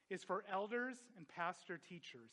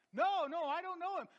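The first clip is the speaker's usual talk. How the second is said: high, loud, hammed-up denial; insistent high-pitched protest; frantic denial; 12 semitones higher, 7 dB louder than usual. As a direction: act out Peter protesting to his accuser. Acted denial by Peter.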